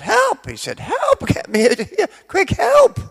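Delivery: high, loud cries of "Help!"; panicked shouting. Acted. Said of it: "he started shouting out".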